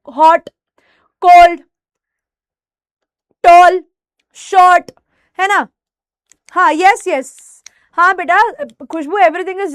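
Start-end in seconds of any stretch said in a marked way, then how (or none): 0.1-4.9 हॉट, कोल्ड, टॉल, शॉर्ट each as one isolated loud beat; single firm syllables, long pauses between हॉट, कोल्ड, टॉल, शॉर्ट; one-syllable demonstration. Shown as a demonstration. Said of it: "बोलकर देखो".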